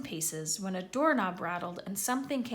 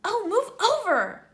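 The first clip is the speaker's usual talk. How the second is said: high, loud impatient snarl; 12 semitones higher, 8 dB louder than usual. Acted. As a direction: shove the boys aside, exasperated. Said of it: "Hermione snarled".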